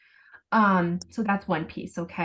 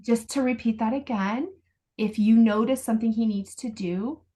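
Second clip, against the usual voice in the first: normal talking voice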